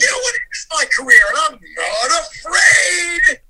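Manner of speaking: loud outburst, stretched out at the end; defiant shouting. Real, not acted. Guest defending his career choice to the hosts, defiant shouting. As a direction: shout it defiantly, dragging out the final words.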